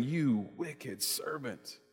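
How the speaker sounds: very soft, slow rebuke; cold anger. Acted. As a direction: let the rebuke land slow and hushed. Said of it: "In anger".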